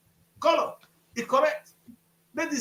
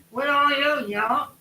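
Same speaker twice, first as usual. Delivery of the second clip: mock heckling crowd; 3 semitones higher, 4 dB louder than usual. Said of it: "President Tinubu was trying to speak".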